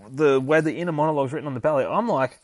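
ordinary delivery

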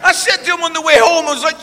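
normal talking voice

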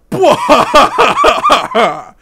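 big incredulous laugh; loud burst of laughter. Real, not acted. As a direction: crack up loudly in disbelief. Laughs at the tweet.

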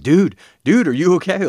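loud, high worried check; acted alarm. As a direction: call out in mock alarm to a hurt friend. Acting out his worried question.

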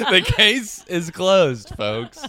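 slow and laughing through it; cracking up mid announcement. Real, not acted.

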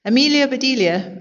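high-pitched call by name. A narrator's reading. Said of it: "Missus Rogers called".

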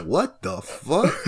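slow, stretched, rising in disbelief; mock bewildered what-the-fuck, dragged out; drawn-out confused. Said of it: "And they go".

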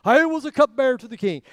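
loud and high, proudly announcing I was a cupbearer. Shown as a demonstration. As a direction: say "I was a cupbearer to the king" with chest out, full voice. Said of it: "I wonder what inflection".

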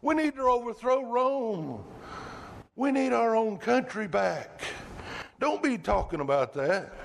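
high, insistent protest; Peter's rebuke. Retold or imitated. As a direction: kings don't suffer, said up high and pressing. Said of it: "he's rebuking Jesus".

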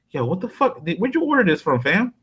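high, surprised "what the fuck"; amazed. Retold or imitated. A friend mocking a pizza fan, amazed and high. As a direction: pitch it up in disbelief.

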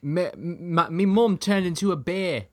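high, loud mock-Scottish accent; Merida. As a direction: stumble into a badly faked Scottish brogue. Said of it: "let me do a Scottish accent".

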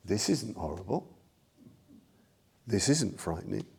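the Buddha gently calming the panicked playboy, soft and calm; calm voice from the darkness. Retold or imitated. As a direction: soothe a frightened man from the dark. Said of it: "a voice just comes out of the darkness".

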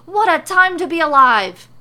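high, very loud exclamation; exultant shout. A narrator's reading. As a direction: proclaim it to the skies. Excited character exclaims with head back.

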